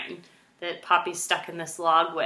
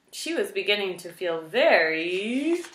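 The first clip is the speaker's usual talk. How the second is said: usual speaking voice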